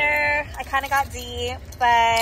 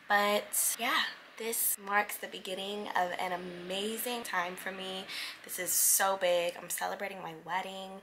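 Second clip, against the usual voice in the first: soft, hoarse early-morning voice; 4 semitones lower, 9 dB quieter than usual. Real, not acted. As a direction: speak with almost no voice left. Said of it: "I have no voice".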